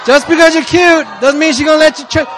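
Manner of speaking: high, loud preacher's outburst; emphatic teasing. Real, not acted. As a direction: shout the point over the crowd. Teasing outburst at single brother.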